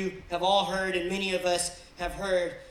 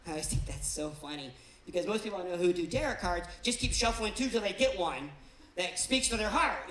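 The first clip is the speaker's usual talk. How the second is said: ordinary talk, nothing marked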